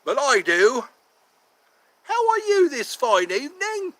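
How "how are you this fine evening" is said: much higher, louder posh English gentleman; a fussy Victorian Londoner, hammed up. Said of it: "who talks like that".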